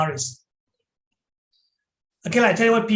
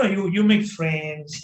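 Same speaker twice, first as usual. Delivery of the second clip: ordinary delivery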